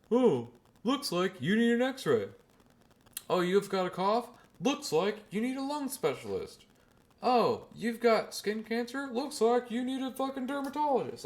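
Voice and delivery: very high, drawn-out silly doctor voice; hammed-up ridicule of a clueless GP, sing-song and high; mock doctor. General practitioner refers every patient elsewhere, mocking high silly voice.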